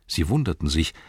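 ordinary delivery

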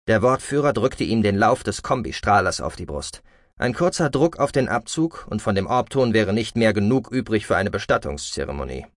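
ordinary delivery, nothing marked

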